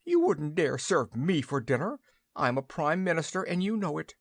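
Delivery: a touch of a pompous goose; indignant goose. A narrator's reading. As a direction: puff up with wounded pride. Outraged goose protests.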